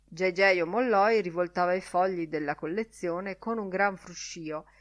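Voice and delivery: usual speaking voice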